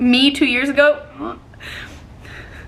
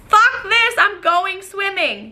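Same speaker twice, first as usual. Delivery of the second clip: higher, decisive; defiant declaration; 8 semitones higher, slower than usual. Retold or imitated. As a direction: announce it defiantly. Defiant quote.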